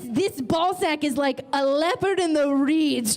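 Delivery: ordinary delivery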